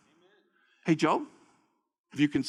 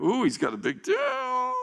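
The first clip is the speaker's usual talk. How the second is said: high, wondering ooh; 13 semitones higher than usual. Real, not acted. Marvelling aside.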